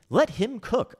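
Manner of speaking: high-pitched mocking imitation; silly, sneering squeak; mocking meme-speak. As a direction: parrot a cringey meme in falsetto. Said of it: "I hate these memes".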